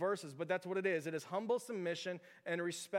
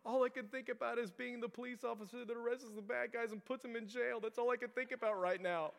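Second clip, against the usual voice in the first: higher-pitched whimpering kid imitation; mock crying child; 6 semitones higher than usual. Son confessing to dad at bedtime, mock tearful. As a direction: play a little boy wailing his confession. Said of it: "crying a little bit about it".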